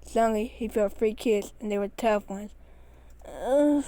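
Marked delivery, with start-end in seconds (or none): 3.2-3.8 short pained grunt; strained grunt. A narrator's reading. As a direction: grunt from the kick. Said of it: "he grunts".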